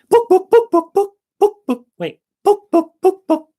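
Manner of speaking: long run of loud high clucks; chicken squawk. Acted. The chicken orders a pile of books, squawking. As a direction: cluck on and on like a hen.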